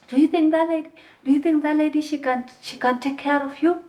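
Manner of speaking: high, challenging mock scolding; retold angry yelling. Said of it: "she started yelling at me".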